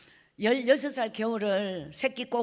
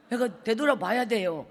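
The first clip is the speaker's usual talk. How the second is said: normal talking voice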